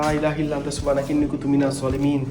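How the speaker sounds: soft, steady recitation of verses; murmured prayer. Real, not acted. Ghost hunter softly recites verses in the haunted room. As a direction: recite for protection, low and even.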